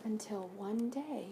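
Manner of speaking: hushed ominous build-up; suspenseful. A narrator's reading. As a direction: drop the voice to tease what comes next. Suspense before page turn.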